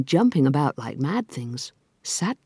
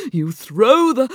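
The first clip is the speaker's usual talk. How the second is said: high, loud, building eagerness; raised, eager creature voice; excited Mock Turtle; 9 semitones higher, 9 dB louder, slower than usual. A narrator's reading. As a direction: build excitedly toward a shout.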